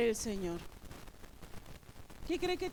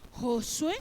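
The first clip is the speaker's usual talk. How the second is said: louder, driving sermon voice; fervent preaching; 5 dB louder than usual. Acted. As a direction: preach it to the congregation with rising force.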